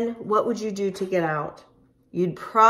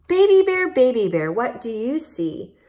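loud sing-song; 5 semitones higher, 6 dB louder than usual. Acted. Rhyme reread loudly.

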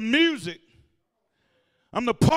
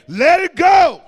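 shouted call; 7 semitones higher, 9 dB louder, slower than usual. Real, not acted. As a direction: let it go, shouted high and loud. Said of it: "Somebody say".

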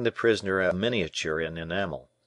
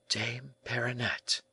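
soft, slow, higher-pitched murmur; hushed echo of the name; 5 semitones higher, 8 dB quieter than usual. A narrator's reading. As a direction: murmur the name slowly, in wonder. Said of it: "murmured the young man".

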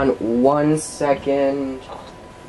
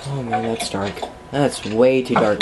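ordinary talk, nothing marked

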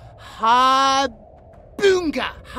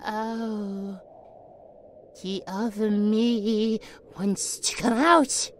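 put-on subdued childlike report; 9 semitones lower, 8 dB quieter than usual. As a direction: let it sink down quietly as another self stirs. Another self surfacing.

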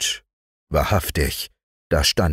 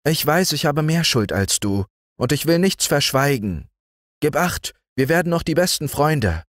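put-on higher, eager boy's voice, at times quick; earnest boy messenger; 9 semitones higher than usual. Boy bringing good news from his doctor father. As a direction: reassure the family, bright and eager.

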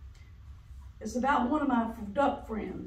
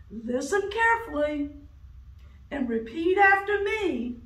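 loud, high booming recital; elephant voice; 6 semitones higher, 6 dB louder than usual. Acted.

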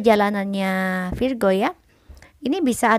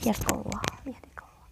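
whispering; 11 dB quieter than usual. Real, not acted. Whispers after sneezing.

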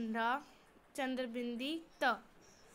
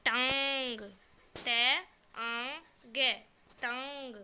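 louder, slow spelling of टांग; 7 dB louder, much slower than usual. Shown as a demonstration. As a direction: call out टांग piece by piece. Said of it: "बोल कर पढ़िए". (टांग ज़ोर से, अक्षर अक्षर बोलिए)

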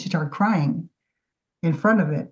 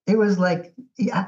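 usual speaking voice

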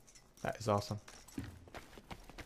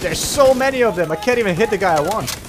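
frustrated shout; 7 semitones higher, 6 dB louder than usual. Real, not acted. Frustrated under fire in game.

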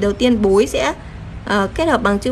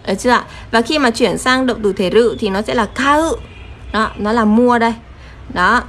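ordinary talk

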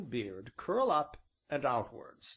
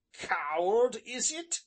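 louder, higher, stung outburst; offended smith's raised challenge; indignant cry; 9 semitones higher, 4 dB louder, slower than usual. A narrator's reading. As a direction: throw the insult back at him, rising sharply. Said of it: "cried he".

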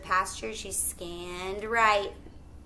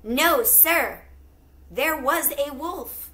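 insistent plea; 5 semitones higher, 6 dB louder, slower than usual. Acted. Betsy pleads with the farmer.

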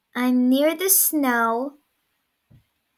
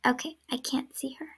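whispering; 3 semitones higher, 5 dB quieter than usual. Real, not acted. Hiding game, seeker can't find.

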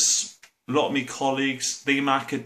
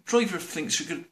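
normal talking voice

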